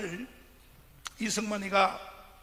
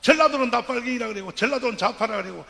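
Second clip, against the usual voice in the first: preaching at full force; 7 semitones higher, 14 dB louder than usual. Acted. Preacher rousing the rally crowd to rise up, full-force shout.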